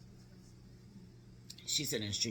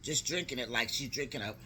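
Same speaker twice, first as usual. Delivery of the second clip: plain reading voice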